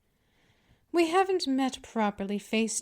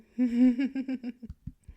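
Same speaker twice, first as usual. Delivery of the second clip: gloating laugh. Acted. Mistress gloating over her helpless pet. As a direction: savour your power.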